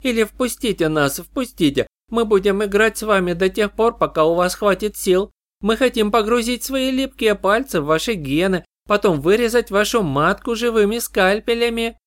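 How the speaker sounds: high, eerie chorus of voices; eldritch horror. Acted. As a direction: demand it like a swarm of insect sisters. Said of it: "слившись в единый хор".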